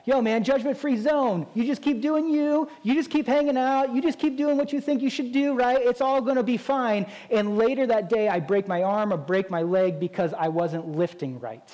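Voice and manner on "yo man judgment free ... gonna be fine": higher, breezy dude voice brushing it off; careless gym bro. Retold or imitated. Preacher mimicking a careless gym guy, higher and breezy. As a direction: toss it off like a carefree gym bro. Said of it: "someone walked by and be like".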